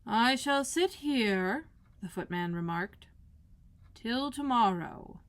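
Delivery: slow, lazy drawl; slow footman. Acted. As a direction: stretch each word idly.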